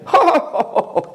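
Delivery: a high, mocking laugh, imitated. Dealer laughing off meeting Francis Bacon, high mock laugh. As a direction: laugh at the idea like the dealer did. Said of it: "He's laughing".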